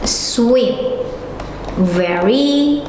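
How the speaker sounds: low, slow, word-by-word dictation. Shown as a demonstration. Teacher gives class an example, slow dictation. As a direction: spell the sentence out in drawn-out pieces with long pauses.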